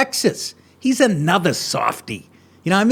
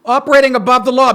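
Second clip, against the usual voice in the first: mocking echo; 6 semitones higher, 5 dB louder, faster than usual. Echoes the clip mockingly.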